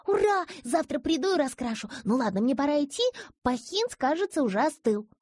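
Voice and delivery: put-on high loud joyful girl; excited child. A narrator's reading. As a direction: cheer like a delighted child. Girl happy on phone. (радостный детский голос)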